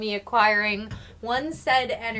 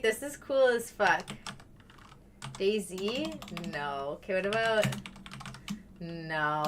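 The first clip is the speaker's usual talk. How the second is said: ordinary talk, nothing marked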